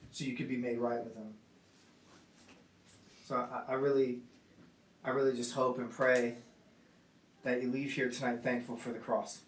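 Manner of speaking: hushed heartfelt sermon close; soft sincere appeal. Real, not acted. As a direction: plead gently with the congregation to be thankful.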